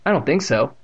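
ordinary delivery

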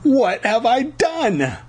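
high, drawn-out horrified wail; shrieking. A narrator's reading. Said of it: "Lauren shrieked".

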